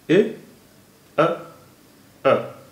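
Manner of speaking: ए, अ, अ as isolated short sounds with gaps; separated vowel sounds. Shown as a demonstration. Teacher breaking syllable into its vowels, separated vowel sounds. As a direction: sound out ए, अ, अ one at a time. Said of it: "इसमें तीन vowel sounds हैं".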